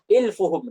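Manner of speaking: "ilfuhum" with the long vowels dropped; unvowelled misreading. Shown as a demonstration. Reader without vowel marks guesses, "ilfuhum" with short u sounds. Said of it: "kalau nggak ada harokatnya mungkin dibaca".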